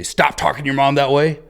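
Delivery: yelling dad. Acted. Father scolds disrespectful son, yelling. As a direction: yell it like a father telling off his son. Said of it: "Do you yell at him".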